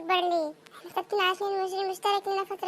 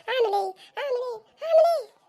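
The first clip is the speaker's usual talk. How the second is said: put-on fretful child, building; 6 semitones higher, 5 dB louder than usual. Child frets to father about the dollar, building up. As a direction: climb from near normal to a high, loud cry.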